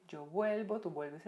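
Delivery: ordinary talk, nothing marked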